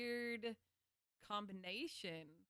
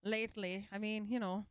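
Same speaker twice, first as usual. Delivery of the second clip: put-on Irish lilt, louder; Irish accent; 6 dB louder than usual. Shown as a demonstration. Showing the other streamer an Irish accent. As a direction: play up a loud Irish accent.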